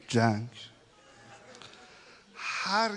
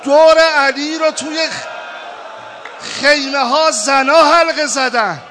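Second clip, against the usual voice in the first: loud, high, wailing sermon; full-force preaching; 9 semitones higher, 11 dB louder than usual. Acted. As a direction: cry out the battle scene. Preaching battle scene at full force.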